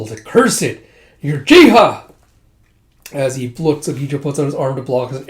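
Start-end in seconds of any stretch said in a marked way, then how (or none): 0.2-2.0 high loud angry shout. Retold or imitated. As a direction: spit it out in anger. Angry in battle.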